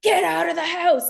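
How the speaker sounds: loud, high commanding yell; firefighter voice. Retold or imitated. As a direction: bark the order like a firefighter. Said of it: "It's like the firefighter voice".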